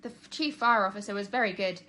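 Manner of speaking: plain reading voice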